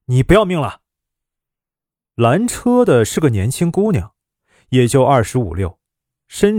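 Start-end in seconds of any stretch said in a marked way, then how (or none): 0.1-0.8 mild mock yell at someone in the road; scolding yell. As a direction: curse the stranger out the window. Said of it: "探出脑袋骂道". (探出头骂人)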